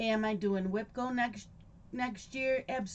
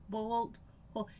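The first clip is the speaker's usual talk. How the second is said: ordinary delivery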